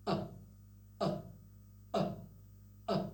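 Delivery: sharp glottal catch on uh, uh; clipped throat-stop syllables; glottal stop demo. Shown as a demonstration. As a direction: stop the air in the throat then release. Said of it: "look at me".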